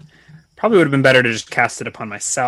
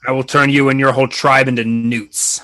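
commanding villain, a touch louder; cold rasping evil emperor; 4 dB louder than usual. Acted. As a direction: loom over them like a tyrant. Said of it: "I respond in Hobgoblin".

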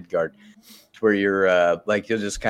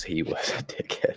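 whispered aside; 7 dB quieter than usual. Real, not acted. Quiet aside agreeing on Baldur.

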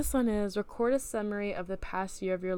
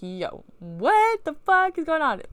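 high, loud, drawn-out disbelief; stunned outburst; 9 semitones higher, 8 dB louder, slower than usual. Acted. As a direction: stretch it out in hammed-up shock. Dream: second man hugs the speaker.

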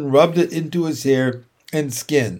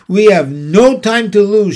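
raised, urgent narrated shout; 5 semitones higher, 7 dB louder than usual. A narrator's reading. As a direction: call it out loud and high, hurrying everyone. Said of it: "shouted Mister Zuckerman".

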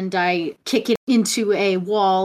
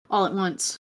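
ordinary talk, nothing marked

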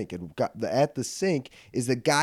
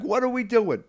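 mock yell, pitched way up; retold yelling; 10 semitones higher than usual. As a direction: cry out in disbelief at him. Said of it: "I'm yelling at him".

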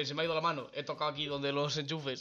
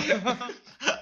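loud laugh; 9 semitones higher, 8 dB louder than usual. Real, not acted. Laughing again.

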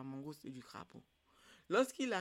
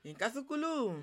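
loud, high chanted call-out; ringing call, high then falling; storyteller's call; 6 semitones higher, 5 dB louder than usual. Shown as a demonstration. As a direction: call out to the audience for their response. Storyteller's call for audience response.

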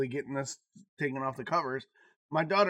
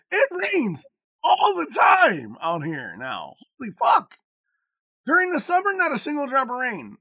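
louder, higher, exasperated rant; loud rain rant; 10 semitones higher, 8 dB louder than usual. Real, not acted. Venting to a friend about the constant rain, loud and exasperated. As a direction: complain about the weather at full volume. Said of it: "ranting about the rain".

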